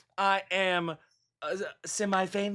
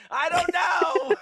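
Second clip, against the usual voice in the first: screaming; 8 semitones higher, 3 dB louder, slower than usual. Pretend.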